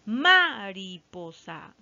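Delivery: "Mariposa" slowly, with a long emphatic first syllable MA; wrong stress on MA. Shown as a demonstration. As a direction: hit MA in "Mariposa", then let the rest drop. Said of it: "vamos a probar con ma".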